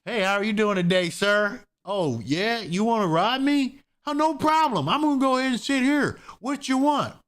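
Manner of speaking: high, sing-song overly friendly victim; mock naive victim. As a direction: play the clueless victim, cheerfully giving in. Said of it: "You're not gonna be like".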